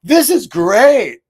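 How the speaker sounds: loud, high, drawn-out mock cheer; fake positivity. Mocking himself bypassing a hard experience, fake cheerful. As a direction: gush it slowly with exaggerated false delight. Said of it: "I don't wanna be like".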